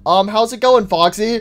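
ordinary delivery

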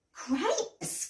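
high, breathless exclamation of shock; gasping. A narrator's reading. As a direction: gasp the word out, amazed. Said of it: "gasped Mum".

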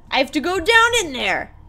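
high, loud protesting exclamation; loud dismay. Real, not acted. Player finds they have to go down in there, loud dismay. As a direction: cry out in disbelief, pitch jumping up.

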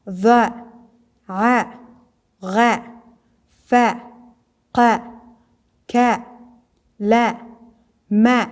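each letter from "ا، ب، ت" to "ی" as one short "a" syllable; letters with fatha. Shown as a demonstration. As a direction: drill "ب، ت، ث" as crisp separate syllables ba, ta, tha.

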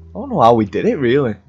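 normal talking voice

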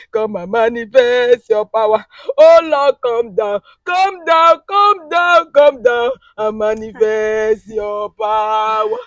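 pleading prayer chant, rising in force; fervent prayer. Real, not acted. Woman pleading with God in prayer. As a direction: pray it out, begging for power.

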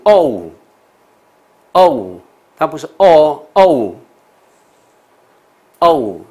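哦 loud and drawn out, ending in oo; long O with oo glide. Shown as a demonstration. Teacher contrasting long O for students, loud and stretched. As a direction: belt 哦 slowly, sliding into oo. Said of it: "后面一个带个乌的声音".

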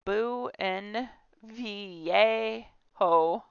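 "Buen viejo" stretched out very slowly, each syllable placed; deliberate syllable-by-syllable Spanish; slow Spanish attempt. Shown as a demonstration. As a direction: sound out "Buen viejo" with great care. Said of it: "I can't say it".

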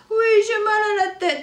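put-on a sleepy child begging to stay in bed; tired little boy. Tchoupi whining to maman that he's too tired.

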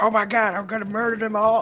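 imitated battle cry. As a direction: play up a friend's bloodthirsty excitement. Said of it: "He's like".

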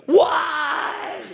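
high, sharp mock yell at God; a short, high cry of protest; yelled why. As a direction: fling the word up at the sky in frustration. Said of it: "Has anybody ever yelled that at God?".

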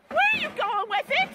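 high-pitched demand called after him; alarmed calling out. Real, not acted. Mother to son hauling off the TV, high alarmed call.

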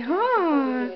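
ordinary talk, nothing marked

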